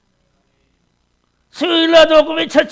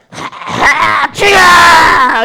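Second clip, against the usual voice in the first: slow tearful shouted cry, much higher; crying out Lord; 4 semitones higher, 5 dB louder, much slower than usual. Retold or imitated. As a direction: wail the Lord's name. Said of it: "이러지". (울부짖듯 길게 외치는 "주야")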